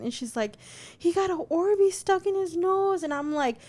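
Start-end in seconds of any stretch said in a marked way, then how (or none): 1.0-3.0 high-pitched mock wail; retold cry in a high child voice; imitated crying child. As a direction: imitate an upset little girl tattling. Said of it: "screaming, crying".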